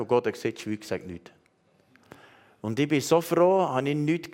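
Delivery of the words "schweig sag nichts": hushed command. Acted. God's command quoted.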